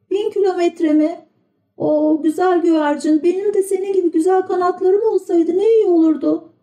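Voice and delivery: put-on high, plaintive young puppet; boyish puppet voice. A narrator's reading. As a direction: sad little wooden boy. Pinokyo wishes for wings.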